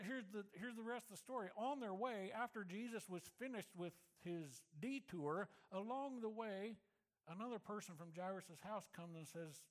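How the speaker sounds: ordinary delivery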